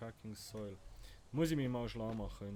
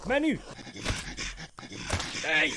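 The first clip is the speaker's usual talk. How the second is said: higher, louder frantic cry; furious shout; 7 semitones higher, 13 dB louder than usual. Real, not acted. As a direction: yell it at the screen. Stuck in game menu, panicking.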